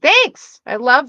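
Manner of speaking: bright, delighted thank-you; excited thanks. Real, not acted. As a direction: squeal it out happily. Chat compliments her ears.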